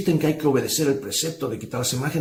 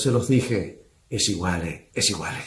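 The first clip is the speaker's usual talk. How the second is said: put-on smug Italian accent; 6 semitones lower, slower than usual. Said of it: "Se lo dijo con una sonrisa".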